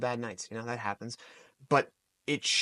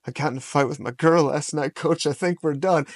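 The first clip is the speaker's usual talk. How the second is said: lightly voiced heartbroken teen, higher and louder; upset teenage wrestler; 3 semitones higher, 7 dB louder than usual. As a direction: imitate a teen confessing to his coach. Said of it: "a sixteen-year-old in wrestling".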